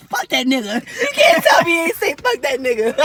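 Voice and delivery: loud laughing exclaim. Real, not acted. Laughing at the imagined quote. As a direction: laugh through it.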